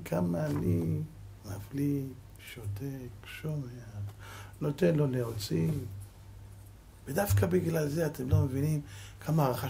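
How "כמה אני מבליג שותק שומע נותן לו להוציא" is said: each word softer, down to a near hush; fading to silence. Real, not acted. Preacher tells listeners how he keeps quiet, fading hush. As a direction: let it sink into restraint. Describes holding back while being insulted.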